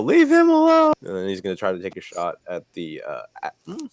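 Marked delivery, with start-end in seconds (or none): 0.0-0.9 high, loud protective shout; yelling. Acted. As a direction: bellow it to drive it off. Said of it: "Ariatsu's gonna yell".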